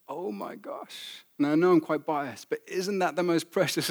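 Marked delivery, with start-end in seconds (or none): 0.1-1.3 slow, near-whispered reaction; hushed retold astonishment. Father reacting to his crying daughter, hushed and moved. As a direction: breathe it out slowly, deeply moved.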